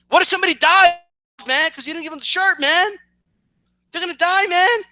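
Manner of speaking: hammed-up, high-pitched panic; mock alarm. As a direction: plead as if lives hang on it, mockingly. Mocks the YouTuber's overblown outrage.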